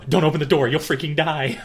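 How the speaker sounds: loud, high panicked warning, acted out; freaking out. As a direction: imitate someone frantically stopping a friend. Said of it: "He immediately starts freaking out".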